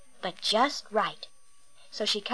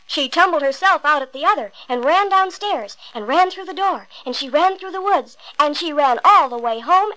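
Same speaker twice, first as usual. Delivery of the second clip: excited chase; 4 semitones higher, 7 dB louder than usual. A narrator's reading. Narrator builds the chase.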